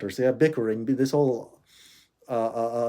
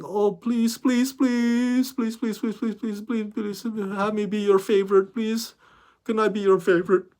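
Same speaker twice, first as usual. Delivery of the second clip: high, soft, whiny mock plea; mock begging; 6 semitones higher, 4 dB quieter than usual. Refusing to beg studios to like him, mock begging. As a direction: grovel in an exaggerated, rapid string of pleases. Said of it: "groveling or begging like".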